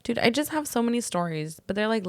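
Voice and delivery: normal talking voice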